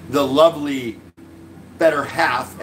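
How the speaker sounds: ordinary talk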